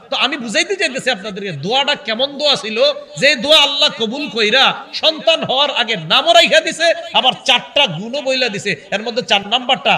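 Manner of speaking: high, loud, emphatic sermon delivery; fervent preaching. Acted. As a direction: drive the point home to the congregation. Preacher drives point home.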